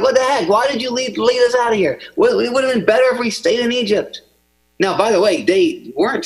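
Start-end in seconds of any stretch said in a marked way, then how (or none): 0.0-4.2 higher, whiny mock complaint; complaining Israelites. Pastor voicing the panicked Israelites to Moses, mock whine. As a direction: whine it like a grumbling crowd. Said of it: "they're like".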